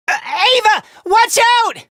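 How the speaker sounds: high-pitched urgent cry; alarmed warning. Acted. Warning Ava of danger, alarmed cry. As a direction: blurt the name, then cry out in alarm.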